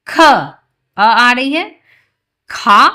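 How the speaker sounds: loud, slow syllables for pupils to repeat; loud teaching call. Shown as a demonstration. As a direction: call it out so the class can follow. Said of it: "आप बोलिए". (ज़ोर से बोलकर सिखाना)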